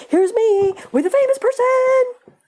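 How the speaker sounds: mocking a vain fan posing with a celebrity. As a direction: squeal it like a show-off fan. Mocking self-focused fan.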